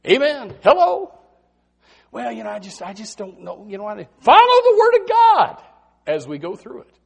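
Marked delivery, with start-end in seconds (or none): 2.1-3.6 mock hesitant mumble. Mocking a hesitant doubter. 4.2-5.6 sudden high shout. Real, not acted. Preacher crying out the Word to his congregation, ringing shout.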